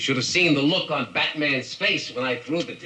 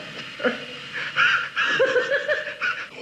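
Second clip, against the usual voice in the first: very high giggling cackle; manic cackle. Acted. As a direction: cackle through the words. Villain laughs at his plan.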